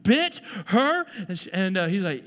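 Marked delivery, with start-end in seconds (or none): 0.0-1.1 hammed-up parental shock. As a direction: react like a stunned parent. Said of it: "he said, say to the other kid".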